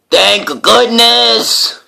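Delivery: very high, slow relieved owl cry. Acted. Owl relieved at story end.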